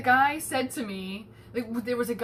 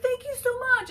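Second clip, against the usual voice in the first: mock gushing thanks. Voicing grateful man.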